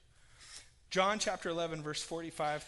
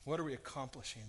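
softer, lower and restrained; hushed council voice; 6 semitones lower, 7 dB quieter, faster than usual. Acted. Preacher reading the council's words, hushed and low. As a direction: read it low, like a secret meeting. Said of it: "Hear the pounding on the table".

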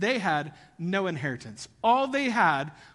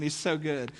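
ordinary talk

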